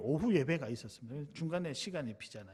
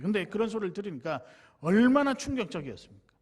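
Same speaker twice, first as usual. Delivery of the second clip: usual speaking voice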